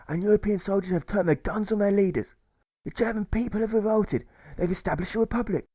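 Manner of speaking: a lightly voiced suffragist giving a fervent speech; suffragist's rousing speech. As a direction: lift the voice for the spirited suffragist telling Alfred the news.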